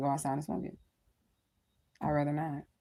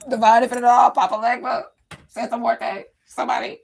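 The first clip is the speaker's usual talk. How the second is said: calling out loud to the spirits, pleading; 5 semitones higher, 9 dB louder than usual. Retold or imitated. A woman about to cry calls out loud to her spirits for help. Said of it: "Like, "Oh".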